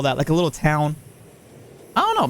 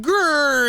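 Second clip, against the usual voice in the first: cartoon growl; 12 semitones higher than usual. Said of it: "then she's like".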